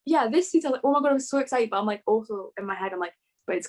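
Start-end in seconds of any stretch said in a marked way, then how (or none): none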